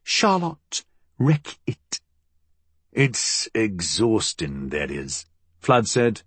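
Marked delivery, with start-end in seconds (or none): 0.0-2.0 slow, higher-pitched, syllable by syllable; quiet, drawn-out name. A narrator's reading. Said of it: "Molly said quietly". 2.9-5.3 slow, sinking low; weary drawl. A narrator's reading.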